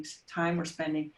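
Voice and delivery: usual speaking voice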